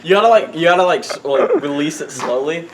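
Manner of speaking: ordinary talk, nothing marked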